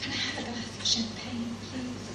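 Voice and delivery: mock sultry film-star request at the bar; hammed-up glamorous ordering, softly; Marilyn Monroe. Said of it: "Marilyn Monroe style".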